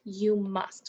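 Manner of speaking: ordinary talk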